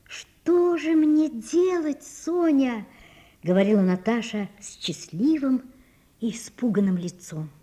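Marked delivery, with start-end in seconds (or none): 0.1-2.9 very slow, high, aching plea; pleading lament. A narrator's reading. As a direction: draw it out in helpless longing. Natasha helpless before Sonya.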